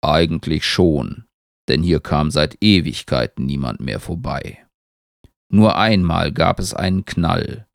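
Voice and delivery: low, unhurried voice of a talking tree; Baum, the tree. A narrator's reading.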